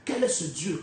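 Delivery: normal talking voice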